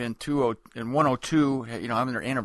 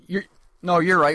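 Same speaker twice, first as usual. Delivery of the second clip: usual speaking voice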